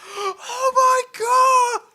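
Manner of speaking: very high, slow, loud wail of horror; hysterical scream. Acted. Game master as the man who shot his wife, hysterical screaming. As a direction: scream it hysterically, stretching each word. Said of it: "starts screaming hysterically".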